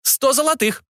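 high-pitched price announcement; market dealer. Acted. Dealer naming a price to a stranger, high-pitched declaration. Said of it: "заявил бизнесмен".

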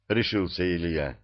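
usual speaking voice

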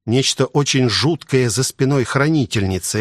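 usual speaking voice

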